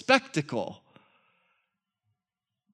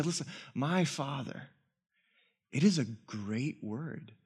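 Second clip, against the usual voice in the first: softer, hushed gentle plea; 4 semitones lower, 7 dB quieter than usual. Retold or imitated. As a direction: ease him toward it gently. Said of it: "very gentle".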